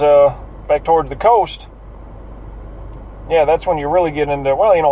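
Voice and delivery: ordinary talk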